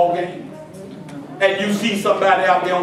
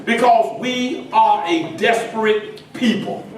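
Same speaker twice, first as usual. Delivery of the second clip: usual speaking voice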